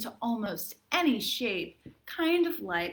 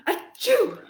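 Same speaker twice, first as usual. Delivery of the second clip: high, loud hammed-up achoo; cartoon sneeze; big fake sneeze; 11 semitones higher, 8 dB louder than usual. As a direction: throw a huge theatrical sneeze. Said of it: "a pretty dramatic reading".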